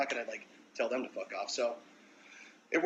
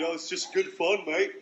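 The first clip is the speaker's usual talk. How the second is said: cheery mock British accent, a bit higher and louder; mock British bloke; 5 semitones higher, 4 dB louder than usual. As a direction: play the jolly British fan shrugging it off in a British accent. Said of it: "How do you take it?".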